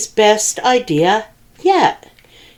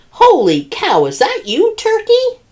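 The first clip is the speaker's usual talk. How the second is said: rising way up in surprise; high astonished question; surprised pig; 14 semitones higher than usual. Acted. Pig spotting Turkey in costume, climbing high in surprise.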